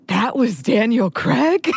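high, drawn-out mock disbelief; astonished gasp. Mom turns to daughter as the credits roll, astonished. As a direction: imitate a gasped, incredulous question. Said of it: "audibly gasps".

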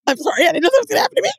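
high-pitched mock tearful wail; mock crying. Girl apologises for breaking down, mock crying. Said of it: "they're in the corner crying".

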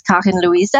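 Karin Luise in a German accent; German pronunciation. Shown as a demonstration. Guest gives her name in German, German accent. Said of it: "my name in German is".

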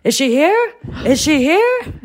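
high, rising eager question; eager calling out. Retold or imitated. Jerry calls out from the dressing room, high and eager. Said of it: "And I just hear".

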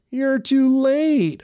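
very high, slow cackling-witch drawl. A narrator's reading. Witch gloating at the heroes, very high and slow. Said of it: "another witch said".

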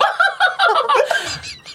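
high cackling laugh; burst of laughter. Real, not acted. Retelling her reaction, high cackling laugh. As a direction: laugh out loud in disbelief. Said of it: "我立刻大笑".

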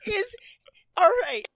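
hammed-up teary delight; mock gushing. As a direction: pretend to be overcome with joy.

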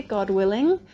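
ordinary talk, nothing marked

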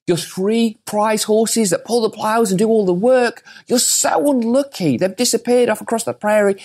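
high, pitying busybody voice, well above his own; mock-pitying village gossip; village folk lament. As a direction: wail it like fussing villagers. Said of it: "The village folk said".

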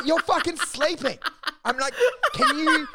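high, laughing mock outrage; laughing disbelief. Comedian to dozing audience member, laughing disbelief. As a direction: laugh through the accusation.

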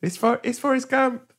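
high, delighted repeat; excited echo. Real, not acted. Host repeating Lou's film choice, high and delighted. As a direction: echo the answer with glee.

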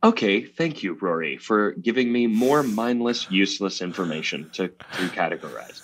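low monotone, robot-like man; Adam Shanley. Acted. Co-host mimics Adam thanking Rory for useless information, robotic. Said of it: "that is a great impression of his voice".

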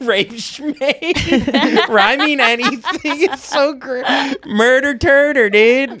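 high, slow dude voice through laughter; laughing surfer dude. Retold or imitated. Laughing through the surfer bit, high slow voice. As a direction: drawl it while cracking up.

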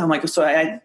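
normal talking voice

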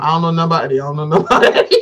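low mock denial, a laugh at the end; lightly voiced Candace brushing it off; imitating Candace. Gossiping host imitates Candace to her cohost, low mock denial. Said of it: "And Candace going".

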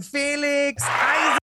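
drawn-out high boxing ring shout; ring announcer. Acted. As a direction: bellow the name like a fight announcer. Introducing guest like ring announcer.

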